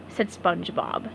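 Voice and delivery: ordinary delivery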